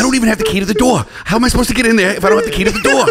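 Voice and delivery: high, flustered actor impression; mock helpless protest, laughter creeping in; Mark Wahlberg. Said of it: "Do you think Mark Wahlberg's".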